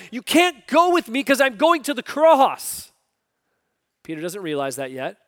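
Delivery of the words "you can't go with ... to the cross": loud, high declaration; high, loud preaching voice; Jesus's words. Retold or imitated. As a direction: proclaim it loudly. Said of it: "Jesus is basically telling him".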